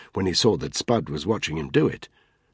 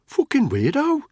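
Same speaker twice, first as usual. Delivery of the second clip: much higher-pitched Brummy grumble; Brummy mutter; 4 dB louder than usual. A narrator's reading. Cab driver gossiping about another driver, Brummy mutter. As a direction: grumble it high in a Brummy accent. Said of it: "muttered in a Brummy accent".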